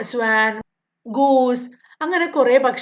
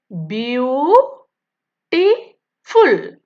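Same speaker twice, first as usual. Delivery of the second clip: Beautiful said slowly as ബ്യൂ, T, ഫുൾ; three syllables split; 4 dB louder, much slower than usual. Shown as a demonstration. Teacher counting syllables of beautiful for pupils, slow and split. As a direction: tap out beautiful in three beats. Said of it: "മൂന്ന് words ഉണ്ടല്ലേ?".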